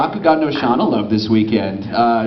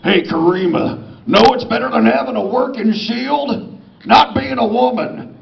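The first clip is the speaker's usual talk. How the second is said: smug Dave; 9 semitones higher than usual. Acted. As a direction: taunt her like a smug misogynist. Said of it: "How about this?".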